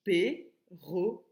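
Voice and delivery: "Perroquet" slowly, each syllable on its own. Shown as a demonstration. Teacher corrects the exercise, "Perroquet" slowly split in three. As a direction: chop "Perroquet" into three spaced beats. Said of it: "quand j'enlève une syllabe".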